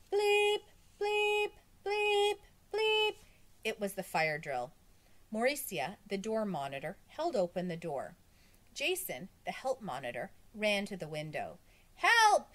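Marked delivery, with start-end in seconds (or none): 0.1-3.2 high, loud electronic-style bleeps; imitated alarm. The fire drill alarm starts in the school, high loud bleeping. 11.9-12.5 high, loud scream; screamed cry for help. A narrator's reading. Help monitor screams out of the window, high and loud. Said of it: "he screamed".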